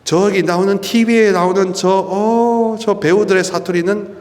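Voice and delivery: normal talking voice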